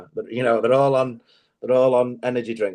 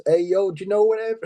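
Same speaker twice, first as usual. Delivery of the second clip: higher, put-on bro voice; sauna gym lad; 9 semitones higher than usual. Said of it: "he talks like this".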